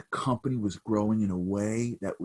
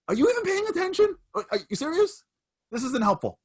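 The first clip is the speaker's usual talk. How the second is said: much higher, strained and incredulous; exasperated disbelief; 4 dB louder than usual. Retold or imitated. Son snapping at the questioning old man, exasperated. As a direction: demand he take this seriously.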